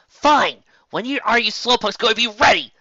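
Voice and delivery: high, loud complaint; impatient shout. Acted. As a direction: hurry the slowpokes. Rainbow impatient.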